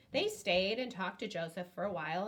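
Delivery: plain reading voice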